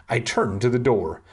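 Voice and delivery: ordinary delivery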